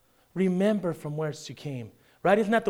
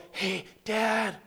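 put-on child's loud whisper; 4 semitones higher, 4 dB quieter than usual. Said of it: "Children think they're whispering".

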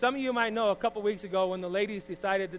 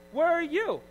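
higher mocking call, a bit less high, falling at the end; mock high call repeated; 10 semitones higher, 6 dB louder, slower than usual. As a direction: repeat the mock cry, slightly calmer. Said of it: "and I'm like".

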